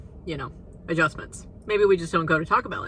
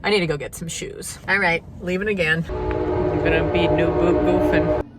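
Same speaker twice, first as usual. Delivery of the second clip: usual speaking voice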